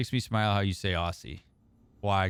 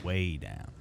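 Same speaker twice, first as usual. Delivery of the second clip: Deep South accent; 6 semitones lower, 7 dB quieter than usual. Shown as a demonstration. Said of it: "easy for me to go down south".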